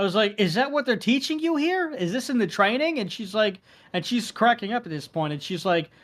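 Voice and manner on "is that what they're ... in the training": high, incredulous questioning; sarcastic high disbelief; mock outrage. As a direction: act scandalized by the rule.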